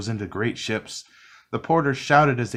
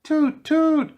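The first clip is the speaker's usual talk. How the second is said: very high, louder scared toot; frightened toot; 6 dB louder than usual. A narrator's reading. Frightened tugboat answers the owl with a high toot. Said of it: "cried the frightened tugboat".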